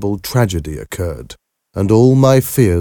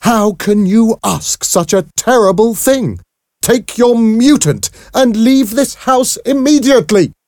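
very high, furious shouting; outraged shout; 4 dB louder than usual. A narrator's reading. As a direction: shout her out of the house. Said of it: "Then Mother began to shout".

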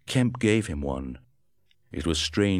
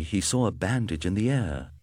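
ordinary delivery, nothing marked

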